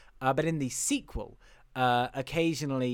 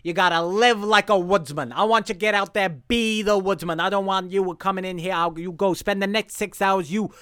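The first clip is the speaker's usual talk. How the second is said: loud, raised-pitch director's pep talk; pushy director; 4 semitones higher, 8 dB louder than usual. Retold or imitated. Lizard director instructing the actor before his callback, pushy director. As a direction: harangue the actor, loud and insistent. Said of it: "the director goes".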